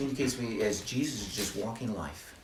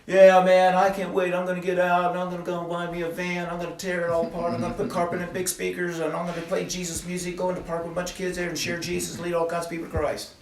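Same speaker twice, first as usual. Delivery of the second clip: high, loud, breathless run-on excitement; imitating gushing enthusiasm; 8 semitones higher, 7 dB louder than usual. [name] shares his vision with the group, gushing in mock excitement. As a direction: gush it like an over-eager dreamer, pitched high.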